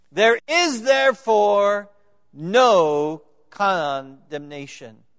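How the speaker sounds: slow, loud proclamation, stretching the words "no condemnation"; proclaimed shout. Acted. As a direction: call it out slowly like a herald of good news. Preacher proclaims key verse.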